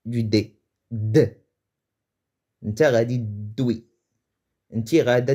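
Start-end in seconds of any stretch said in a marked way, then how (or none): 0.9-1.4 D said alone, strong and emphatic; stressed D sound. Shown as a demonstration. Teacher isolating the emphasized D sound. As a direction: punch the D sound on its own. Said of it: "on accentue la prononciation du D".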